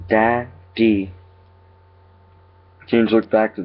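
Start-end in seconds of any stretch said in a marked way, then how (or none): 0.1-1.1 lightly voiced creepy child mimic; a hint of an eerie child, syllables pulled apart; Daniel's voice. A narrator's reading. Creature calls the father again, lightly mimicking his son. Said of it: "it talked in Daniel's voice".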